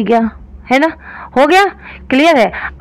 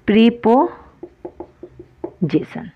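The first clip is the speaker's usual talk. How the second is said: drawn-out term; 5 dB quieter, much slower than usual. Shown as a demonstration. Teacher to students, stressing preposition, very slow. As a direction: stretch the word preposition very slowly. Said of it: "teacher drawing out key term".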